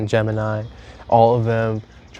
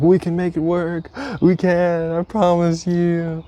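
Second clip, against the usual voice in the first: pleading reassurance; 7 semitones higher than usual. Retold or imitated. Pleading with crying girlfriend on phone.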